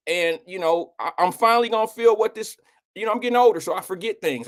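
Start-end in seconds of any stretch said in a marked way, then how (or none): none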